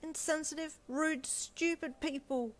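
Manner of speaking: high, slow spat-out insults; raging insults. A narrator's reading. Wife venting about reporters to Deku, slow high raging insults. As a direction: spit each insult out. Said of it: "you raged".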